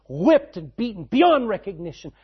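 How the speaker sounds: a preacher building the crucifixion to a peak; building preaching. Real, not acted. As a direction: climb to a full shout.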